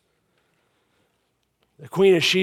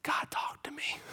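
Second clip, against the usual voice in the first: whispered, overcome, imitating tears; retold bawling; 16 dB quieter than usual. Young man returns to the preacher next day, retold bawling in a whisper. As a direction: barely voice it, as if choked up. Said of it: "he comes to me bawling".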